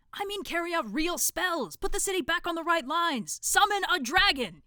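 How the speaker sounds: loud, high commanding shout. Acted. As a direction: thump the book and bellow. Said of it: "he shouted".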